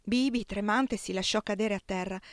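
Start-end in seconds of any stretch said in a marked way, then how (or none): none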